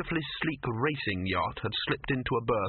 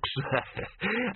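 salesman laugh; 11 semitones higher than usual. Acted. Dibbler laughs.

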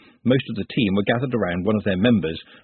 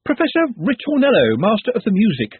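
ordinary delivery, nothing marked

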